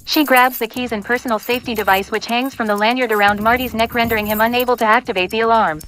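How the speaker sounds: ordinary talk, nothing marked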